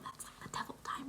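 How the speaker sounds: very soft, whispered confiding aside; spooky hush. Real, not acted. Confiding fear of three in the morning, hushed whisper. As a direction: drop to a whisper.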